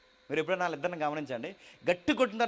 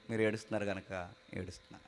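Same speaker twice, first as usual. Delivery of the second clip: tender reply; 9 semitones lower, 10 dB quieter than usual. Retold or imitated. God answering tenderly.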